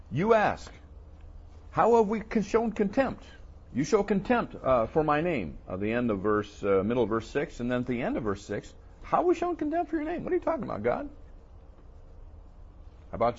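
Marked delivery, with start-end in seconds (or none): 1.7-3.2 higher, louder offended protest; mock indignant complainer. Preacher voices Israel denying contempt, mock outrage. Mocking the people questioning God. 9.0-11.1 high, louder whiny protest; mock indignant complainer. Preacher mimics Israel doubting God's love, whiny mock protest. Mocking the people questioning God.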